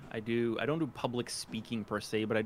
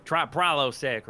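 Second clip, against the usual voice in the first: slow, louder mock commercial pitch; mock ad read; 3 semitones higher, 7 dB louder, slower than usual. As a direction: parody a commercial tagline, stretched out and punched up. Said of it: "I don't wanna be like".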